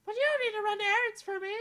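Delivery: put-on high, bright young princess, wistful; a lovely princess doubting fairy tales; princess Amelia voice.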